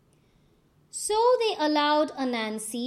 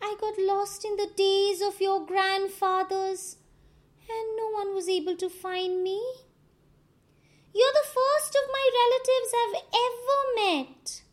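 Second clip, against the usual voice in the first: much higher, louder tearful pleading; crying; 9 semitones higher, 5 dB louder than usual. A narrator's reading. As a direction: sob out the lie to win pity. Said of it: "He cried so much".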